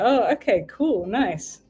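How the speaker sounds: ordinary talk, nothing marked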